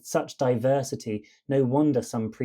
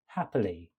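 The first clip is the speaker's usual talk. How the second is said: "Happily" low and soft, all three syllables equally light; no syllable stressed; 6 semitones lower, 6 dB quieter than usual. Shown as a demonstration. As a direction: say "Happily" evenly, leaning on no syllable. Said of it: "we start with no sound stressed".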